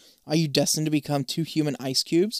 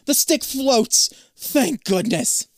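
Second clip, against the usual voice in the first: high, slow, loud exclamation; excited relief; 9 semitones higher, 5 dB louder, much slower than usual. A narrator's reading. You surface gasping from the pool, excited relief. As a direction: cry out with drawn-out relief.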